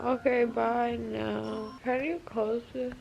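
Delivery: slow, soft, drowsy post-surgery voice; groggy from anesthesia. Real, not acted. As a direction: numb lips, dazed and sleepy. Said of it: "it's already wearing off her".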